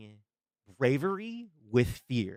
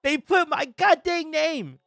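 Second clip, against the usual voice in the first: thrilled squeal; 11 semitones higher, 4 dB louder than usual. Real, not acted. Streamer sees own name in trailer.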